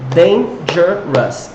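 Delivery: "dangerous" slowly, one syllable at a time; split into syllables. Shown as a demonstration. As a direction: break "dangerous" into three distinct beats. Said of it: "three syllables".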